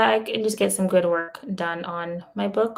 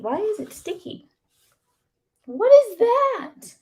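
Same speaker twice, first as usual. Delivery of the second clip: disgusted surprise; 12 semitones higher than usual. Real, not acted. Finds sticky stuff under desk.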